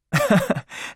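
a bright little laugh; light laugh. A narrator's reading.

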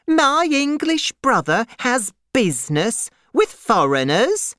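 high-pitched incredulous outcry; crying out. A narrator's reading. Miss Pross learns of her brother's business with foreigners, incredulous cry. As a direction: question it high, aghast. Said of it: "cried Miss Pross".

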